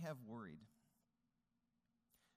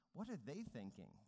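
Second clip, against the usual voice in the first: ordinary delivery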